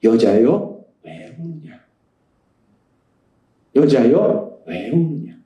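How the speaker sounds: low, tender voice of Jesus asking; Jesus speaking. Acted. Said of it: "예수님이 말씀하신 거예요".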